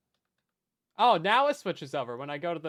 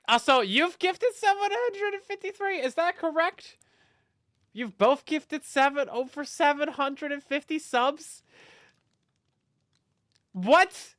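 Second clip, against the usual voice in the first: much higher, amazed question; disbelieving; 8 semitones higher, 5 dB louder, slower than usual. Real, not acted. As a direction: squeak it out in disbelief. Disbelief at gift counts.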